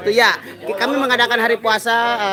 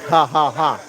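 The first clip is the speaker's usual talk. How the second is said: low villain cackle; evil laugh; 5 semitones lower, slower than usual. Shown as a demonstration. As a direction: cackle like a bad guy. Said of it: "Saya ketawa jahat".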